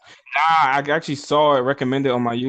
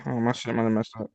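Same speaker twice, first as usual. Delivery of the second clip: normal talking voice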